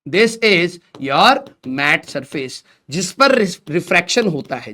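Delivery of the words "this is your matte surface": even slower, every word stretched; slow repeat. Shown as a demonstration. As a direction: repeat it slowly for notes. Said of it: "ये वाला जो आपका surface है".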